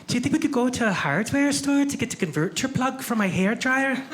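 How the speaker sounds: high Scottish woman's voice; lilting Scottish visitor voice; Scottish accent. Retold or imitated. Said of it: "the first thing she said was".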